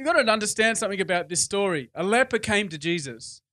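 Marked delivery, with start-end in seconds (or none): none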